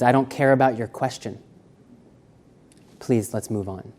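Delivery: mildly curt, uninterested interviewee; dismissive rudeness. Acted. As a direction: brush the question off quietly, as if not caring. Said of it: "Short answers can seem rude".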